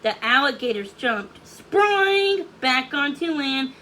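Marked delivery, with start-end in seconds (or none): none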